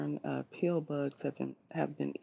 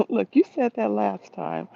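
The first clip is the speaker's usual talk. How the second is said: laughing and louder, teasing the viewer; 4 semitones higher, 6 dB louder than usual. Real, not acted. Teasing a viewer who repeats herself, laughing. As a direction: laugh it out as a playful jab.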